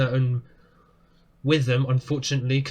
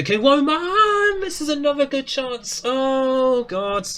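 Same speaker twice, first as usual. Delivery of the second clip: high, loud, building to a shout; excited shouting; 12 semitones higher, 4 dB louder, slower than usual. Real, not acted. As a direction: call the chance with rising excitement. Another big chance falls.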